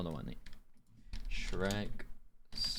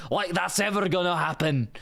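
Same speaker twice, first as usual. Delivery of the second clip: put-on higher-pitched, scoffing Scottish accent; full-on Scottish accent; 9 semitones higher, 4 dB louder than usual. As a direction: scoff at the fairy tale like a Scot. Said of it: "Just like a full-on Scot".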